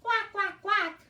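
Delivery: high quacking duck sound. Shown as a demonstration. Teacher shows children how a duck sounds, high quacking. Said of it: "They go".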